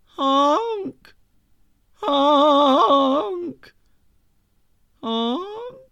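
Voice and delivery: high honking goose imitation. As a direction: honk like a goose. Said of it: "all I could do was honk".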